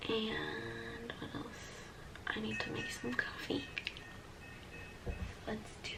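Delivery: hushed whisper so others sleep. Real, not acted. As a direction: whisper softly, people asleep nearby. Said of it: "I'm whispering 'cause people are sleeping".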